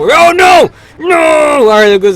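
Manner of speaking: high, loud cry of dismay; dismayed shout. Real, not acted. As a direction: yell as the speedrun falls apart. Run fails, shouts in dismay.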